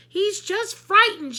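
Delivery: high loud slow pleading shout. A narrator's reading. Miss Sookie defending her pet, a loud pleading shout. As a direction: defend the bird loudly. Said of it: "shouted Miss Sookie".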